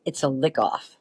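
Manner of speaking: a hint of a dry put-on voice; deadpan retort. Friend answering a question about her tattoo, deadpan. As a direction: toss it off coolly. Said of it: "she goes".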